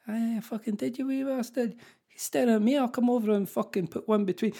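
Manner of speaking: a mock-aggressive Scottish brawler; exaggerated Scottish. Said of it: "she'll just go super Scottish".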